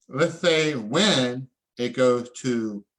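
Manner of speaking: ordinary talk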